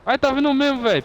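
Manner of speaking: high alarmed cry; panicking gamer. Real, not acted. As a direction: yelp as it comes at you. Truck coming in game.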